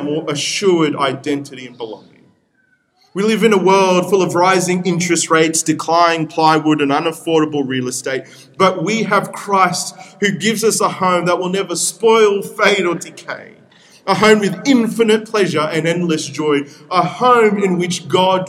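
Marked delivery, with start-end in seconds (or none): none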